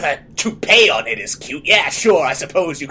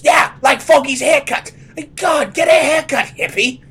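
angry mocking shout; 8 semitones higher, 4 dB louder than usual.

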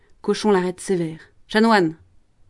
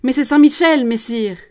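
loud, high protest; Jeanne cries out; 5 semitones higher, 7 dB louder than usual. Acted. Jeanne protesting to the promoteur, loud high cry. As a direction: blurt it out in defence. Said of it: "Jeanne crie".

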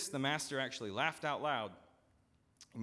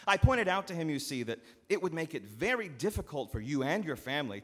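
fast, loud, higher sales pitch; barreling steward; 6 semitones higher, 7 dB louder than usual. Acted. Steward barreling on at the farmer, loud and eager. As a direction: steamroll him with a friendly pitch.

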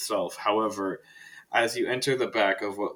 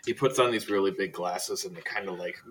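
ordinary talk, nothing marked

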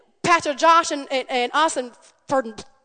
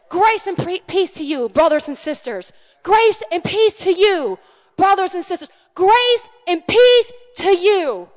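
blessing; 5 semitones higher, 5 dB louder than usual. Acted.

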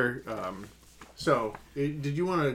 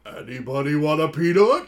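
louder, put-on giant voice, innocent; Fezzik; 6 dB louder than usual. Fezzik teasing Vizzini with a peanut, louder put-on voice. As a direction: ask it like a gentle giant teasing.

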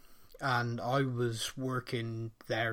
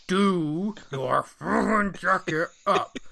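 slow, louder, insistent warning; urgent repeated warning; 5 semitones higher, 5 dB louder, much slower than usual. Retold or imitated. To his mate sipping beer, a slow insistent warning. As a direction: spell it out word by word so he gets it.

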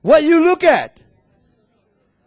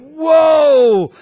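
amazed whoa; 4 semitones higher, 4 dB louder than usual. Retold or imitated. Retold amazed reaction.

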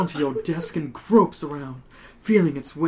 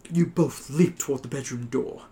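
ordinary talk, nothing marked

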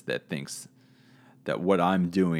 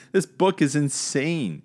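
real laugh through the words; 8 semitones higher than usual. Real, not acted. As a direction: laugh out the line.

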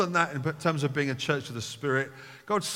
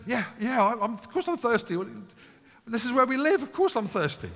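much higher, keen mock agreement; preacher voicing an eager listener, mock-enthusiastic; eager crowd member; 8 semitones higher than usual. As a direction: play a listener who is instantly hooked.

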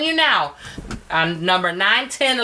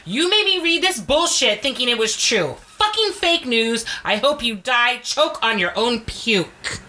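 drawn-out, pissed-off tirade, pitch jumping up; re-enacted angry rant; 3 semitones higher, slower than usual. Reader performs the angry reply they typed to the article, ranting. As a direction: act out your furious all-caps message. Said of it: "this is what I typed".

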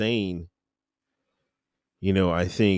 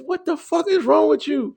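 high-pitched retold tirade; mock drill sergeant. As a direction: imitate a sergeant chewing out a recruit. Retold drill sergeant chewing him out.

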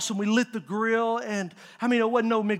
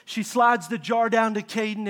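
normal talking voice

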